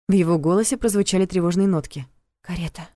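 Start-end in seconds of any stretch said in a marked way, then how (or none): 2.4-2.9 hushed, breathy whisper. A narrator's reading. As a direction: breathe the word out in alarm. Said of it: "прошептала я".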